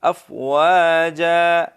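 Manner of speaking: افواجا ending on a long ا, drawn out; pausal reading. Shown as a demonstration. Teacher shows how to stop on افواجا. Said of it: "ہم وقف اس طرح".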